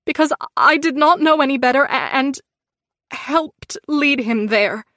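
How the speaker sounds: rising then slowing, choked; tearful confession. A narrator's reading. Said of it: "There were tears in my eyes now".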